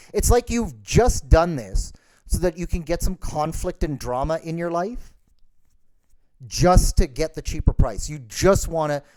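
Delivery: normal talking voice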